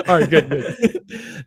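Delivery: laughing through the words. Real, not acted. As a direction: chuckle it out slowly. Laughing after mispronunciation.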